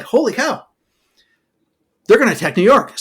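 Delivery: high, hammed-up gasp of alarm; mock alarm. British officers reading the captured plans, hammed-up shock. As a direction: act shocked at the news.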